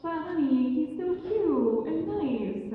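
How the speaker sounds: high, slow coaxing wife voice; pleading wife. Acted. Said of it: "And the wife was like".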